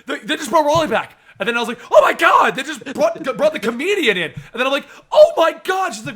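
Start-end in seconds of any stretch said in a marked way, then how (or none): 0.1-1.2 high, loud, laughing news; excited explanation. Retold or imitated. 1.9-4.3 high, loud gasp, then laughing; excited exclamation. Retold or imitated. Reacting while reading comic. 5.1-5.9 high, loud shout of joy; excited shout. Retold or imitated. Reacting while reading comic.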